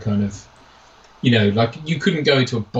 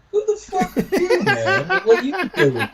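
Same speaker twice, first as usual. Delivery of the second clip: very high voice breaking into laughter; incredulous film hanger-on. Retold or imitated. Fan quoting a character at Lebowski, high laughing impression. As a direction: squeal it in mock disbelief. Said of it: "He's like".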